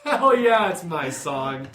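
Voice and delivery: mock-cheering a loud, high "hell yeah"; excited shout. At a concert, cheering that her favorite song came on, loud and high. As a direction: belt it out like your song just started. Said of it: "And I'm like".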